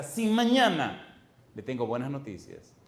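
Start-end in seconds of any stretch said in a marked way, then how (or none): 0.0-1.2 voz alta y lenta, clamando; anguished cry. Acted. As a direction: clama la palabra como un lamento. Lista dramática. (grito lento y desgarrado)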